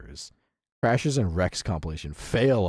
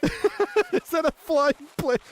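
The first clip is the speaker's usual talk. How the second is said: slow, much higher, laughing through it; laughing amazement; 3 dB louder, slower than usual. Real, not acted. Streamer watching a car fly, slow laughing amazement.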